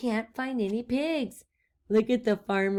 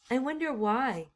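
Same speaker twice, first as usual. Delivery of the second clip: ordinary delivery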